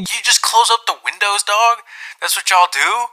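high, loud disbelieving retort; landlord's answer repeated back in loud, high disbelief; incredulous shout. Retold or imitated.

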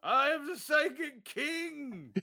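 slow, high, booming proclamation; dark lord. Pretend. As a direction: proclaim yourself king of all. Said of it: "Declaring yourself king".

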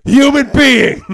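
loud, slow, high bellow; shouting at the phone. Pretend. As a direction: yell it into the receiver. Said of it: "I'm gonna pretend to call you".

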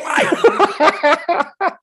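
loud high laughter; big burst of laughter. Real, not acted. Laughing hard while retelling the best shot, loud and high. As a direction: crack up at your own story. Delighted burst after the quote.

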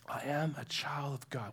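soft, whispered declaration; hushed affirmation. Shown as a demonstration. Preacher offers a truth to live out of, hushed and whispery. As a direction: drop to a reverent near-whisper. Preacher voices inner identity statement.

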